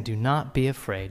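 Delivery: Jesus comforting the fallen John, tender and soft; gentle reassurance. Acted. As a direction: say it like a hand on the shoulder.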